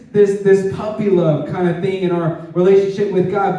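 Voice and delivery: normal talking voice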